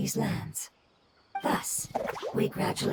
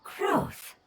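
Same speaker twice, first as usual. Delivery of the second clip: wondering; 9 semitones higher than usual. Acted. Savoring a newly learned word.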